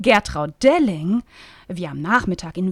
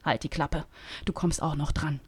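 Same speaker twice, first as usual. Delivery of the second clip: lower, softer hissed reply; whisper back; 5 semitones lower, 8 dB quieter than usual. Acted. Lynn whispering back to Bettina, lower and softer. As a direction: hush her sharply, low and soft. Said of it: "flüsterte Lynn zurück".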